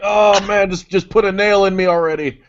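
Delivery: high, strained groaning plea; suffering ancient man. Pretend. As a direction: moan like you're in agony. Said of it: "It's like".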